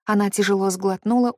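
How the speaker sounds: usual speaking voice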